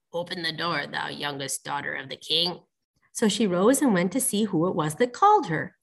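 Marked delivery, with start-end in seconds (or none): 0.1-2.6 low, soft frog voice; frog at the door. A narrator's reading. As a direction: call quietly and low as the frog. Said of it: "and a voice said".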